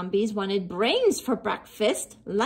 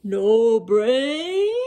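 loud puzzled zombie question; 5 dB louder than usual. Acted. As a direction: ask it like a baffled zombie. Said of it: "the other zombies asked".

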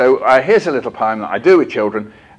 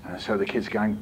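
usual speaking voice

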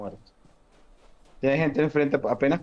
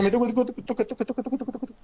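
rising, higher urgent pleading; 9 semitones higher, 4 dB quieter, much faster than usual. Real, not acted. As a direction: beg faster, climbing higher. Begging teammate for a revive.

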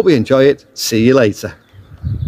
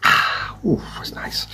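breathy whispered sigh of pleasure after a sip; whispered relish after the first sip; satisfied whisper; 5 semitones higher, 8 dB quieter, slower than usual. Real, not acted. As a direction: whisper it, savouring the beer. Savouring a sip of beer.

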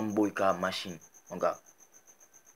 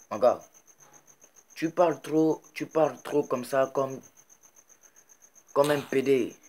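raised, heated scolding with higher pitch; angry scolding; 5 semitones higher, 3 dB louder than usual. Real, not acted. Vlogger angrily scolding rapper [name], raised voice. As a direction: tell him off, voice rising hot. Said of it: "je suis vraiment fâché".

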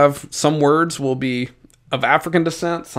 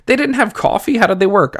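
higher, playful mock astonishment; amazed disbelief; 7 semitones higher than usual. Real, not acted. Teacher joking to students about life without coffee, amazed. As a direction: ask it like it's impossible.

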